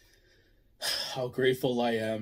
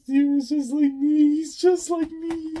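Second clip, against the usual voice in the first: emotional; 12 semitones higher, 3 dB louder than usual. Real, not acted. Relating to Kuma's flashback.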